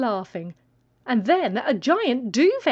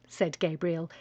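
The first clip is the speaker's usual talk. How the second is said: ordinary delivery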